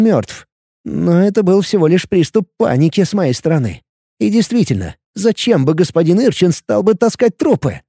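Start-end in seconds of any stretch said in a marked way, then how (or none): none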